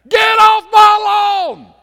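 shrieked high and slow; cranky neighbor screaming at kids to get off his lawn; angry scream. Retold or imitated. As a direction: yell like a furious old neighbor. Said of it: "this guy with his Saint Francis".

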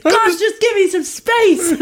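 high, slow, laughing mock groan; exasperated. Teen self to a fussing mum, mock exasperated. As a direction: brush off the fussing with a half laugh. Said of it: "And I'll be like".

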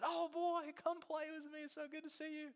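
very high, gushing storybook welcome; excited tree. Acted. Excited tree greets the boy's return, high and overjoyed. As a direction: burst in high and loud, then keep it high. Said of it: "The tree's so excited".

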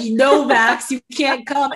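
mock scolding. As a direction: playfully lay down the law, loudly. Acted scolding of friend's boyfriend.

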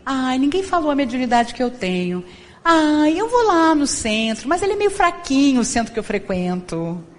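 whiny spiritist. Retold or imitated. Preacher mocking a churchgoer who brags about mediumship, whiny. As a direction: sigh and whine about your unrecognised gifts.

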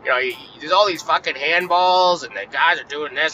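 lightly voiced whiny complainer; mock the sore loser's griping; imitating Paulie's whine. Mocking sore teammate Paulie griping about handballs, lightly whiny voice. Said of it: "he kept saying to me, like".

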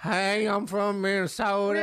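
put-on slow, high-pitched Minnesota accent; mock Minnesota accent. Co-host teases the bad accent attempt, mock Minnesota accent. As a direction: stretch the vowels in an exaggerated Minnesota accent. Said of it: "I don't do accents".

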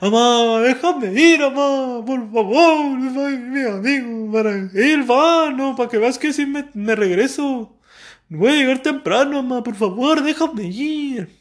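put-on whining kid pleading for permission. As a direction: beg mom with long, wailing vowels.

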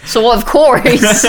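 laughing through a joke. Real, not acted. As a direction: barely hold back laughter.

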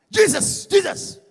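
loud, high cry of the name; calling out Jesus. Shown as a demonstration. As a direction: call the name out plainly. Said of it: "You don't say honorable Jesus".

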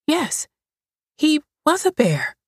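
put-on high, urgent child's voice; scared little girl. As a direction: blurt it out like a frightened child. Mildred answers.

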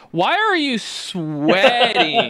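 high, very drawn-out teasing imitation; slow high-pitched mock question; May. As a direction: mimic a taunting friend, stretching it out high. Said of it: "and May's like".

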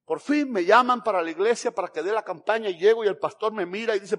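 normal talking voice

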